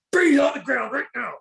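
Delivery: high, loud barked command; retold arrest shout. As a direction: yell it like you're busting him. Bust, yelling at fugitive.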